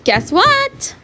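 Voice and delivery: high, lilting "guess what"; mother's teasing announcement. Acted. Mother voiced in a story.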